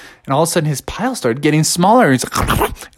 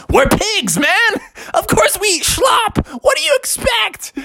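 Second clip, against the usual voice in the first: pigs scoff at the silly question; high pig voice. Acted. Pigs answer bear.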